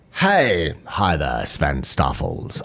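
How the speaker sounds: deep put-on oddball voice; freaky weird guy. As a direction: play the weirdo, dropped far down in pitch. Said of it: "a freaky weird, he's like".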